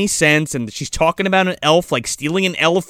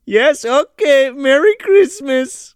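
high, slow, sing-song farewell; a hammed-up holiday send-off; mock cheery goodbye; 9 semitones higher, slower than usual. Kids leaving Santa, mock exchange.

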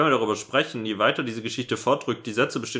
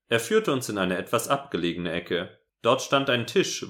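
ordinary talk, nothing marked

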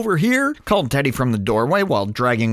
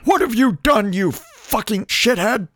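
livid; 8 semitones higher, slower than usual. A narrator's reading. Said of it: "Dude became livid".

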